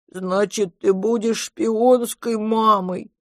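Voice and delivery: put-on high, drawn-out childlike monster voice; cheered-up monster. A narrator's reading. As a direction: brighten up like a consoled little one. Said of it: "повеселев".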